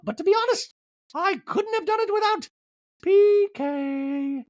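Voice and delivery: very high, sweet, mock-posh voice; squeaky doting impersonation of Dorit; Dorit impression. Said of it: "Dorit in the talking head's like".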